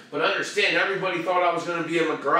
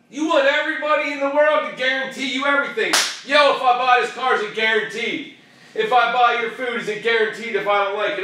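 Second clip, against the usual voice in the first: raised, high-pitched mock demands; mocking rant; 6 semitones higher, 6 dB louder than usual. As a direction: rattle off the demands in exasperation.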